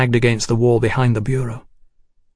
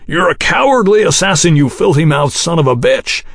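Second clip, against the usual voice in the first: furious shout; 6 semitones higher, 3 dB louder than usual. Acted. Houston rages at the assassin.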